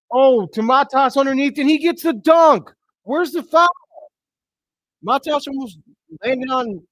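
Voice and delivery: real thrilled shout from a fan calling the game; excited shout. Real, not acted. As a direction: erupt with joy at the big play. Bulls dunk, he erupts.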